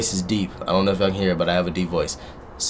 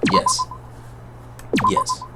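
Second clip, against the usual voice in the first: squeaky high yes; 9 dB quieter, much slower than usual. Acted.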